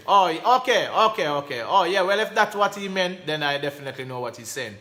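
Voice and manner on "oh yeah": usual speaking voice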